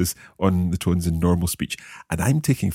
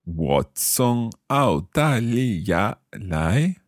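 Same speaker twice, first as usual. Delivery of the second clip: very slow, syllable by syllable; slow learner's repeat; much slower than usual. Shown as a demonstration. Learner repeating the teacher's Chinese phrase, very slowly. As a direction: draw out each tone carefully. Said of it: "saying each of these phrases quite slowly".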